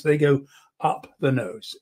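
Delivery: normal talking voice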